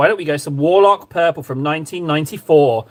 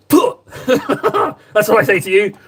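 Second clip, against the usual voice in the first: pig impression; 3 semitones higher, 3 dB louder, much slower than usual. Shown as a demonstration. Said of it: "That's a pig impression".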